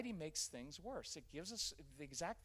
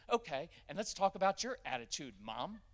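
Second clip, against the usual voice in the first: sassy daughter; 2 semitones higher, 7 dB louder than usual. Retold or imitated. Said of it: "She'll be like".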